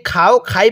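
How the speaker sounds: "খাও", "খাই" with open aa, quickly cited; khaa-o, khaa-i for "খাও", "খাই"; wrong vowel. Shown as a demonstration. Teacher warns against this misreading, "খাও", "খাই" with open aa. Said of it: "পড়াটা সহি শুদ্ধ হবে না".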